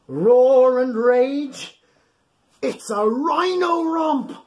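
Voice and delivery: slow, loud and high rhino rhyme; big roaring read. A narrator's reading. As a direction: belt out the roar for the kids. Reading rhino rhyme aloud.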